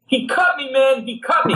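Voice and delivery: high-pitched imitated scream. Slashed guy at the pool table, screaming. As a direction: scream like a drunk who's been cut. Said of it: "he starts screaming".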